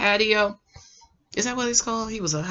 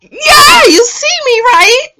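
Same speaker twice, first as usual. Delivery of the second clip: very high, loud mock squeal; gushing imitation; 8 dB louder, slower than usual. Retelling her answer to his proposal, hammed-up high squeal. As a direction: squeal it as an over-delighted woman. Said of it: "She's just like".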